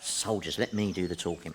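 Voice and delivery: low, quiet warning to a companion; hushed aside. Acted. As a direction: drop your voice, keep it between you. Aside on seeing soldiers.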